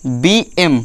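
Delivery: slow, spaced dictation for note-taking. Shown as a demonstration. Teacher dictating notes to the class, slow and spaced. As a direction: dictate word by word so pupils can write.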